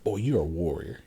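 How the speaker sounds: put-on low, hushed murmur to a baby; retold whisper. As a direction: drop soft and low, as if into a newborn's ear. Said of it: "I whispered in his ear".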